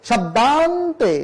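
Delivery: usual speaking voice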